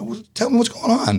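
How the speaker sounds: high, light mock voice for his friend; hammed-up friend asking for the story; voicing Greg. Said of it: "He said".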